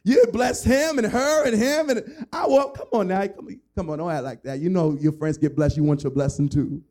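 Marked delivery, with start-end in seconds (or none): 0.0-2.7 high, loud whiny plea to the Lord; pleading friend. Retold or imitated. Friend begging God for the same blessing, high whine. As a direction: beg God like a jealous kid. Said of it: "He said".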